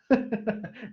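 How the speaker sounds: high villainous cackle; evil laugh. Acted. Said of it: "essa foi a risada maléfica".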